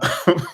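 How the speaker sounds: a brief uneasy chuckle; short nervous laugh. Real, not acted. Recalling his loss, a short nervous chuckle. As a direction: laugh a little, despite yourself. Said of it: "I don't know why I'm laughing".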